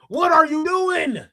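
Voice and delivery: high, drawn-out mock outrage; exasperated outcry. Host calling out the wrestler, exasperated outcry. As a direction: stretch the question out, pitched high.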